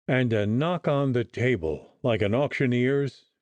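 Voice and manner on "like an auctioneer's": ordinary delivery, nothing marked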